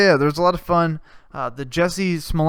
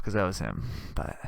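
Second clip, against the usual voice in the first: usual speaking voice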